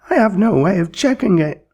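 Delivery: high despairing wail; moaning. A narrator's reading. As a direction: moan it out helplessly. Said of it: "moaned the pedagogue".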